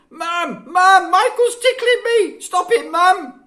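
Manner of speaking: put-on very high, loud shrieking girl. A narrator's reading. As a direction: shriek for mum while being tickled. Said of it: "The girl shrieked".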